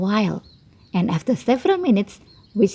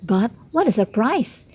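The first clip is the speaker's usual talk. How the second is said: ordinary delivery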